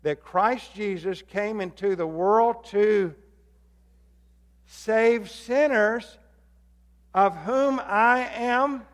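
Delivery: slow, drawn-out reading for the room to say along; slow recitation. Shown as a demonstration. Pastor leading the church in recitation, slowly. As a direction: lead the congregation word by word. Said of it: "And say it with me".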